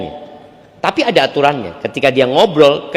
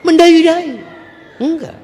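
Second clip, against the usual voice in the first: high, sing-song lilt; lilting coo; 13 semitones higher than usual. Shown as a demonstration. Wife talking to other people, high lilting coo. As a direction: jump up high into a mock coquettish lilt. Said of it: "ngomong sama suaminya tegas, ngomong sama orang mendayu-dayu".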